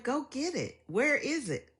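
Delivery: put-on high, naive child's demand; childish demand. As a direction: play the clueless kid asking for money.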